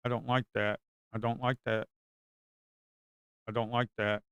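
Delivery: ordinary delivery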